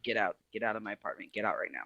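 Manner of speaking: low, soft and fast, no yelling; quiet firm order. Retold or imitated. Ordering the girlfriend out of the apartment, low and quiet. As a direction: push her out without raising your voice. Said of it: "And I don't yell".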